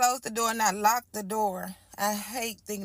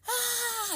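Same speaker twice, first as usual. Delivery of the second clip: sudden high crying wail; a short panicked cry, much higher; retold scream; 13 semitones higher, 4 dB quieter than usual. To her boyfriend bleeding on top of her, a high crying wail. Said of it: "Now I'm screaming".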